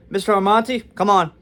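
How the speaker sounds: loud, high mock summons; nurse calling out. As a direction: call a patient in impatiently. Nurse summons him angrily.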